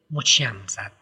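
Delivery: plain reading voice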